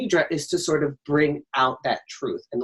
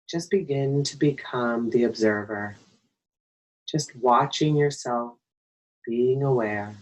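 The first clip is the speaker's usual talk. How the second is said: guided meditation. Acted. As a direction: guide them gently into stillness, phrase by phrase. Leads a breathing meditation.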